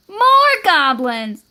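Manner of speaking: loud, drawn-out announcement; triumphant reveal. Acted. Hidden goblins revealing themselves to Goblin, loud and drawn-out. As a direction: announce it gleefully, stretching it out.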